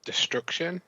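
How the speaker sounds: unvoiced tentative whisper; whispered guess. Real, not acted. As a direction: breathe the guess out.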